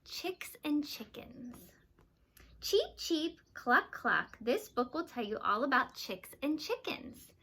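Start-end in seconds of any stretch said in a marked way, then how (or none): 0.1-1.6 whispered title. Acted. Teacher reading title, unvoiced. 2.6-4.4 chicken noises. Shown as a demonstration. Teacher imitating chicken sounds.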